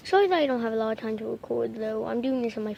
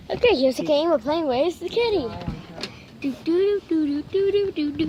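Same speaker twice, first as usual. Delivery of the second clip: playful singsong; 3 semitones higher, 5 dB louder than usual. Real, not acted. Playing with kitty.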